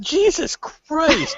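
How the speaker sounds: high, drawn-out disbelief; appalled, stretched-out outburst; exasperated. Real, not acted. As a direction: exclaim it slowly in real exasperation. Scoffing at laser guns in space.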